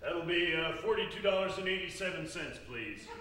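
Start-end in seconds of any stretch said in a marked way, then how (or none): none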